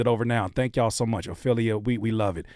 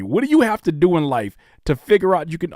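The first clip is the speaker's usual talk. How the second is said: ordinary talk, nothing marked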